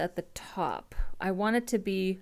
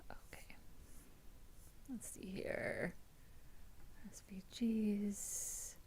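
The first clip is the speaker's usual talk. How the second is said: whispering; 15 dB quieter, slower than usual. Real, not acted. Muttering to self while coding.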